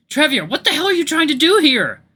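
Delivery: high, loud furious yell; angry shout. Acted. Nary yelling at Draviar holding a knife, furious shout. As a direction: scream at him to stop.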